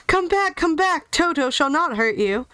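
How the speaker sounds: calling out. A narrator's reading. Tin Woodman holding Toto calls to the fleeing mice. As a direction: call them back, reassuring at the end. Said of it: "he called to the mice".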